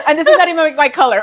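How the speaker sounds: fast, loud, laughing high protest; laughing mock complaint. Wife mocking herself for rejecting compliments, laughing. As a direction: blurt it out through laughter.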